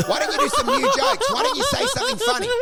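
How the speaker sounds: high-pitched mock heckle; imitated heckler yell. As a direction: re-enact an audience member yelling at the comic. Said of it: "no, he yelled".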